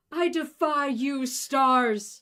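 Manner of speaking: high, loud, drawn-out cry to the sky; a theatrical bellow at the stars; dramatic shout. Acted. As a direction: defy the heavens at full voice. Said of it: "you shout".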